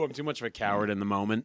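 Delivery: usual speaking voice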